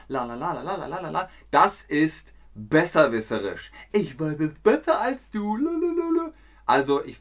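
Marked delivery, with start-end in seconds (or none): 0.1-1.3 mocking la la. Shown as a demonstration. Mocking know-it-all sing-song. 3.9-6.3 high, smug sing-song taunt; higher mocking chant of a smug kid. Shown as a demonstration. Know-it-all taunt demo.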